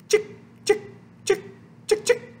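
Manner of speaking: tiny, high, whispery clicks; quick high shaker sounds; sprinkling noises. A narrator's reading. Reader imitates sprinkling sugar on clay, sprinkling noises.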